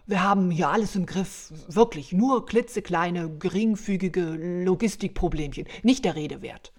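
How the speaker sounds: loud, hurried excuse-making; flustered reassurance. Acted. Santa reassuring his boss on the phone, overeager and loud. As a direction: rush to play down the trouble, loudly.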